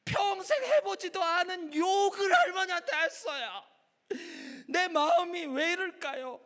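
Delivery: high, loud anguished confession; wailing schoolgirl. Acted. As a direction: cry it out in guilty despair. Girl wails her confession.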